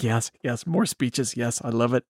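low, soft mock relish; Aaron Sorkin. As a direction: savor it under your breath. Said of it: "Aaron Sorkin's like".

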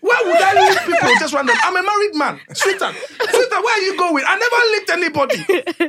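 high, laughing, righteous father; dad denies the beatings to his wife, high and outraged; protesting dad. Retold or imitated. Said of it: "Dad, remember".

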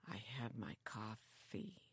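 soft whispered imitation of Bob; whispering Bob. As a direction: breathe it out, barely there. Imitating Bob with his coffee.

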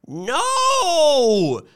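high, loud crying "No!"; old woman's anguished cry. A narrator's reading. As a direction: cry out before the bad news lands. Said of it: "said Missus Bellows ahead of time".

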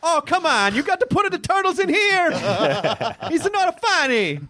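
high-pitched cartoon Italian plumber; mock Italian accent. Interned Italian game plumber complaining, high mock Italian accent. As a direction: protest in a high, hammed-up Italian caricature.

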